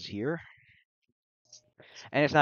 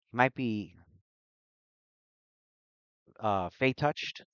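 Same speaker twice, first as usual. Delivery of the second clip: lightly voiced loopy mage, louder; hyperactive mage, scattered; 3 semitones higher, 7 dB louder than usual. Loopy mage rambling to Luna after bumping into her, scattered. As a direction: think aloud in fits and starts, a bit raised.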